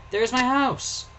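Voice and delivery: put-on high, eager child's cry; a boy proudly pointing out home; excited child.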